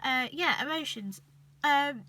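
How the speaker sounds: ordinary delivery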